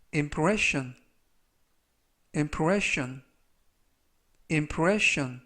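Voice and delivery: "impression" said slowly and clearly, stress on the middle syllable; pronunciation model. Shown as a demonstration. Teacher models "impression" for Korean learners, clear and careful. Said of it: "이라고 발음하시면 되겠고요".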